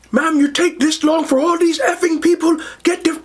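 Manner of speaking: hammed-up yell, pitch way up; mock screaming. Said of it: "he starts screaming".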